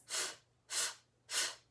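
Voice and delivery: "F" as a voiceless puff, no vowel; letter-sound drill. Shown as a demonstration. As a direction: blow each "F" softly through lip and teeth, separately. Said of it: "Francie Fish says".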